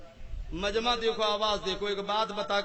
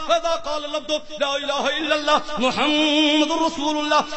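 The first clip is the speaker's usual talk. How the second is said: slow, measured recitation of Arabic hadith; recited Arabic; 6 semitones higher, 8 dB louder, slower than usual. Real, not acted. Preacher reciting hadith to the gathering, measured recitation. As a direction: intone the Arabic text with weight.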